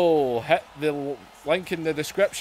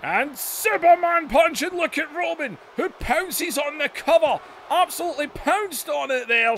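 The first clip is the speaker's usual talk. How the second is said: excited ringside commentator calling the action; hyped pro wrestling commentary, bursts of shouting on big moves; wrestling play-by-play; 3 semitones higher than usual. Acted. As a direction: call every move like a live TV announcer. Live wrestling game play-by-play.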